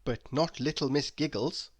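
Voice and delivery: ordinary delivery, nothing marked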